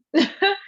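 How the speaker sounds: quick high-pitched giggle; amused chuckle; high laugh. Real, not acted. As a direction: laugh at your own joke. Laugh after joke.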